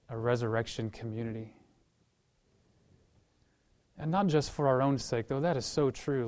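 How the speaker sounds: earnest, steady prayer to God; closing prayer. Acted. Pastor leads closing prayer.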